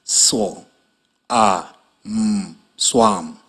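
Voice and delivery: segment "swam" sound by sound, then blend; sounding out words. Shown as a demonstration. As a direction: say "Swo-, a, m" slowly, then "swam".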